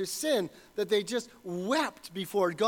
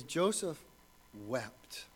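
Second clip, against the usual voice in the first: hushed reverent reading; 7 semitones lower, 6 dB quieter, slower than usual. Acted. Quoting the moment Joseph wept.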